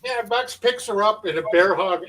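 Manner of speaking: ordinary talk, nothing marked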